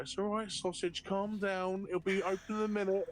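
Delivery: high-pitched soothing flight attendant voice. Retold or imitated. Flight attendant calming an impatient passenger, high placating voice. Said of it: "The flight attendant's like".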